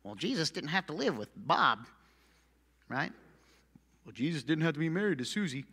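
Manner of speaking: lightly voiced whiny excuse; mock excuse-maker. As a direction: voice the listener's lame excuse. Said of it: "making excuses in your head".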